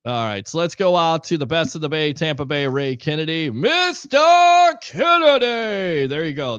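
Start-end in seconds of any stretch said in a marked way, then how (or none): 3.5-6.1 high, drawn-out announcer call; stretched-out hype introduction; mock sound effect. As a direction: bellow the name like a ring announcer. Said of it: "There's your sound effect".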